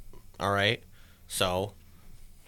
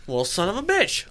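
a higher, mock-exasperated son of a bitch; exasperated curse; 8 semitones higher, 4 dB louder than usual. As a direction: retell the curse with a lift of annoyance. Spots the shadow in the house.

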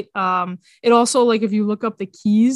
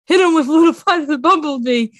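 higher, louder echo of the line; loud movie quote; 5 semitones higher, 5 dB louder, faster than usual. Retold or imitated. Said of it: "he's like".

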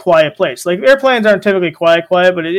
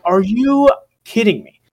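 slow, stretched, incredulous kidding; slow exasperated are you kidding me; drawn-out disbelief; 4 semitones higher, slower than usual. Retold or imitated. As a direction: drag out the disbelief at the crying baby. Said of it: "it's just like".

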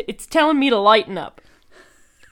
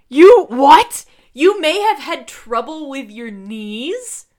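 surprised; 5 semitones higher, 9 dB louder, slower than usual. Real, not acted. Shocked by the knee line.

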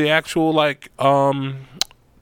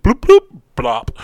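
high, loud cartoon bloops; punchy comic drop noises; imitated bloop sounds; 8 semitones higher, 9 dB louder, slower than usual. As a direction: plop it out like something falling off. Imitating arm dropping off.

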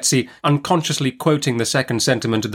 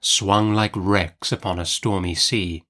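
ordinary delivery

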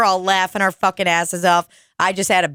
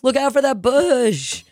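put-on drunk rich guy; 7 semitones higher than usual. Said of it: "He goes".

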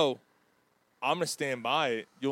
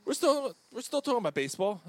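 stutter; 8 semitones higher than usual. Real, not acted. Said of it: "I'm sorry, I stuttered there".

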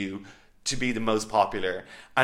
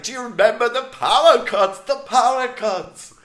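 imitating Tim, much higher and louder, thrilled; excited Tim imitation; 12 semitones higher, 6 dB louder than usual. Mocking housemate Tim recalling power cuts, in a high, thrilled voice. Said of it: "He just gets so excited and animated".

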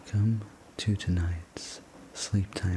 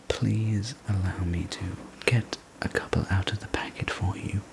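plain reading voice

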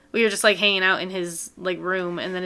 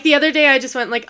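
usual speaking voice

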